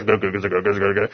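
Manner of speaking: low, soft imitation of muttered grumbling; mock crowd grumbling. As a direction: murmur it like a discontented crowd. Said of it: "You can hear him".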